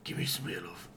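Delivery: breathy whispered growl; werewolf whisper. Pretend. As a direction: whisper it menacingly. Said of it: "you do it then".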